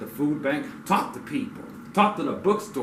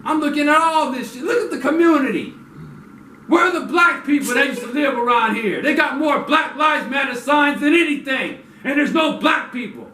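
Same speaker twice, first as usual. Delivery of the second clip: angry rant; 11 semitones higher, 7 dB louder than usual. Real, not acted.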